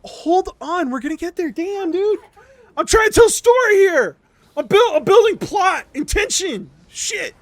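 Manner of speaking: high-pitched, exasperated comeback; indignant protest. Real, not acted. Protesting interruption of the story.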